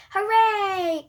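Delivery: high, loud happy hooray; joyful cheer. A narrator's reading. Boy hearing about the park trip, joyful cheer. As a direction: cheer it out with delight. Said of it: "cries Andy".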